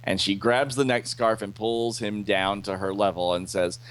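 ordinary delivery

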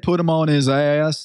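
"ass" said as "eye-ess"; imitated "ass" with an added syllable; extra Y in ass. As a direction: split "ass" in two with a Y glide. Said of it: "We put that extra Y in ass".

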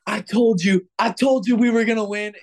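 loud, high crowing, imitated; gloating shout. Field guy to the beaten player after the walk-off, gloating shout. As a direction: rub the win in, hollering.

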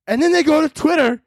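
ordinary delivery

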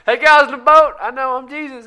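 high, loud mock hail to the boat; Jesus. Jesus showing off to friends in the boat, mock shouting. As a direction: call out showily across the water, pitched high. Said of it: "he walked on water and was like".

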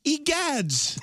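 put-on very high, snooty spoiled rich kid; entitled rich kid. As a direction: whine like a pampered 1800s heir. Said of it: "sound like a rich kid in a hot air balloon".

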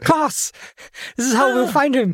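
high shouted wail through laughter; laughing mock wail. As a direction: wail it out cracking up.

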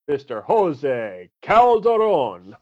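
slow, hammed-up Spanish accent on the name; bad Spanish accent. Drafting his pick, he announces it in a hammed-up Spanish accent. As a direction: roll out the name in a mock Spanish accent, slowly. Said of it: "That's the worst accent ever".

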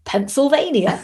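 lightly voiced child nailing the joke; son's punchline. Son delivers the punchline.

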